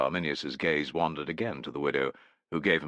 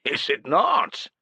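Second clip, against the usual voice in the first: slow, high, loud mock; 11 semitones higher, 6 dB louder, slower than usual. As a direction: needle him with a slow mocking question. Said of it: "Gerulf's tone was mocking".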